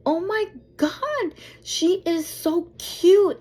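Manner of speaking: high, drawn-out squeal over a cute dog; gushing stranger. Retold or imitated. Woman spotting a cute dog, high gushing delight. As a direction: imitate a delighted, high-pitched gush. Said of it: "she's like".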